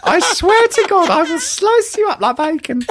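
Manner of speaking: sweet cartoon pig turned menacing; mock threatening Peppa Pig. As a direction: play Peppa as a cartoon gangster. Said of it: "But she was threatening them".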